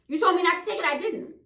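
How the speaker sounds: normal talking voice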